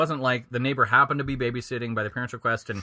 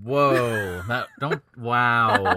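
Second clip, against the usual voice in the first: talking through a mouthful of sausage; words round a bite of dinner; mouth full; 7 dB louder, much slower than usual. Real, not acted. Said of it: "are you finished, uh, swallowing that sausage".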